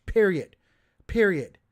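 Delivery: emphatic loud "period", each falling hard; heated. Real, not acted. Streamer ruling out private discussions, heated. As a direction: shut the argument down, heated and loud. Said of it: "I'm getting heated now".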